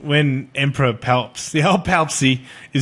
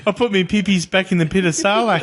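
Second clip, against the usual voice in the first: put-on fast, higher Kiwi accent; New Zealand accent; 6 semitones higher, faster than usual. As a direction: rattle it off like a Kiwi. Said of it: "Sorry for all New Zealanders".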